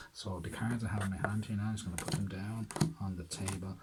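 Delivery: ordinary talk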